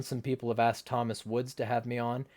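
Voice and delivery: normal talking voice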